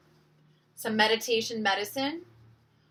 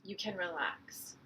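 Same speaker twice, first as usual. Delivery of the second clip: soft, unhurried meditation voice with long pauses; hushed, calming breathwork instruction; guided meditation; 6 dB quieter than usual. Real, not acted. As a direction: gently guide listeners into their breath.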